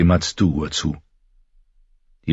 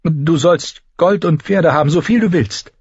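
urgent offer; 12 semitones higher, 4 dB louder than usual. A narrator's reading. Dani desperately offers payment.

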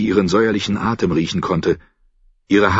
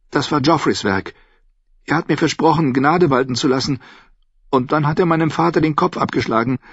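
put-on higher-pitched girl confessing a horror; higher voice, breaking off at the end; distraught young girl; 8 semitones higher than usual. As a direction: pour it out like a hurt girl.

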